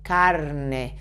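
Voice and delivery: carne with the R rolled on the tongue; carne, tongue-tip trill before the n. Shown as a demonstration. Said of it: "vibrate a little bit your tongue".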